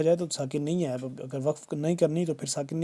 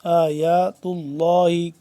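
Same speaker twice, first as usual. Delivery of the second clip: very slow, sustained tajweed chant; measured, melodic recitation for pupils; Quran recitation; 6 dB louder, much slower than usual. Shown as a demonstration. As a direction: hold each long vowel steadily. Teacher recites the verse slowly.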